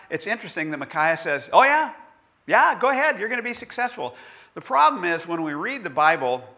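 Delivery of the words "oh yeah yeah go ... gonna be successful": mock-eager high yeah, sarcastic. Micaiah mocking the king, sarcastic. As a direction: sell it as fake agreement. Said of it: "his tone was dripping with sarcasm".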